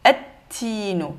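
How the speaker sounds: slow "et-tinu" with no L and a doubled T; lam muet, shadda. Shown as a demonstration. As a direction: hold the T of "et-tinu". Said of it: "on l'oublie". (« et-tinu », T doublé, sans L)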